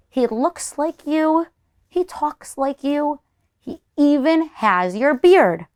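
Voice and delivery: slow, high, wondering voice; suspicious granny. Acted. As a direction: spell out the mystery slowly. Grandmother suspects an impostor.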